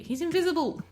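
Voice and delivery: high, loud surprised cry; high loud cry of surprise; startled outburst. Real, not acted. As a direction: blurt it out in shock. Sudden surprise at game bug.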